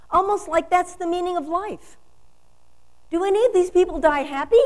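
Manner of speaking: loud, high, ringing declarations; full-force preaching. Acted. Minister to congregation, defiant and loud. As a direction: preach it to the back row.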